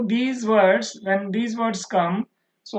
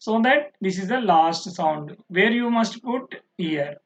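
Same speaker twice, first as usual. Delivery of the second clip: ordinary talk, nothing marked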